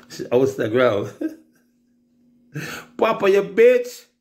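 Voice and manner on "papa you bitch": laughing. Real, not acted. Laughing mock insult to Papa.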